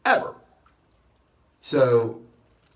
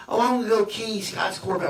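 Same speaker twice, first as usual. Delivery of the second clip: higher, put-on voice of a spiteful old lady; mock old woman; 7 semitones higher, 3 dB louder than usual. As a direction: mimic her breezy plan to key the car. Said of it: "My brother's like".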